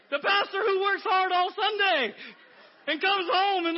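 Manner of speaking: high, loud, laughing sermon build; preaching with a laugh. Acted. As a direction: push it up with a grin.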